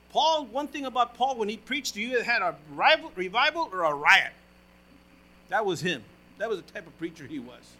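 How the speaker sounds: loud, rising preacher's call to the congregation; full-force preaching. Acted.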